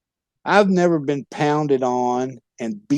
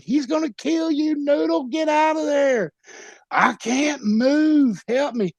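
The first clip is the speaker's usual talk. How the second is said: much higher, frantic yells for help; panicked yelling; 12 semitones higher than usual. Retold or imitated. Watching the bear maul Noodle, much higher panicked yell. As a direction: shriek in terror at your friend. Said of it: "And I was like".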